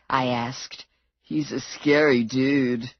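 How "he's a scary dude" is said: slow dazed voice. A narrator's reading. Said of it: "Muner said".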